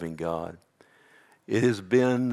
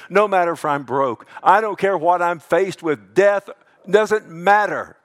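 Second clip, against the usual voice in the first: loud, high preaching voice driving every promise home; preacher to his congregation, proclaiming God's promises at full force; fiery preaching; 6 semitones higher, 7 dB louder than usual. Acted. Pastor exhorting his church on assurance, louder and higher than his calm talk.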